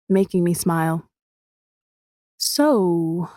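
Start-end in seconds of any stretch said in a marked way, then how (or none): 2.4-3.3 drawn out. A narrator's reading. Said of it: "I drag out the word".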